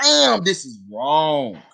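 slow, drawn-out inner groan; guilty thought. Retold or imitated. Thinking to yourself during forbidden sex, slow. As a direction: savour it slowly, half guilty.

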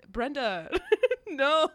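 high laughing break on Brenda, no; giggling "Brenda, no"; laughing protest. Retold or imitated. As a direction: laugh through a mock plea. Retold shocked reaction, laughing.

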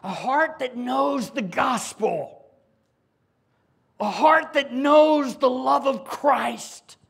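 loud, high and slow, stressing heart; full-force preaching. Real, not acted. Preacher proclaiming to the congregation what true hearts know.

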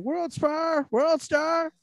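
high excited hype yell; yelling World Star. Pretend. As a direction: shout it like a fight hype man. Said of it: "the person yelling".

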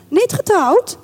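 high, rising double-check; questioning surprise. Acted. Colonel's wife rechecking his bachelorhood, high surprised question. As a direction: lift it into an intrigued question.

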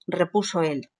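plain reading voice

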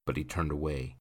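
ordinary delivery

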